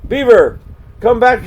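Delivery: high, loud call after him; crying out. Acted. Curlew to fleeing Beaver, a high loud cry. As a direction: call out across the river. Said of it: "Curlew saw him and cried out".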